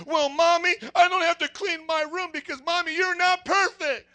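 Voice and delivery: spoiled little brat. Retold or imitated. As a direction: play a bratty kid answering Mommy. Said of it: "talking like spoiled little brats".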